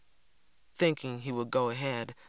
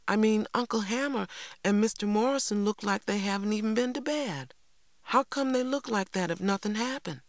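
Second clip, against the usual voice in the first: put-on high, insistent child's argument; protesting girl; 8 semitones higher than usual. Girl argues with brother about tired uncle, protesting.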